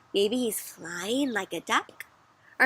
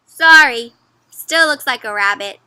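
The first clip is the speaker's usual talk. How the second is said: unconvinced reply; 11 dB louder than usual. Acted. Rabbit believer refuses to agree.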